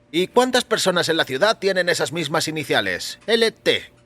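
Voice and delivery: voiced salesman. A narrator's reading. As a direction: shrug it off as the salesman. Salesman replies to owner.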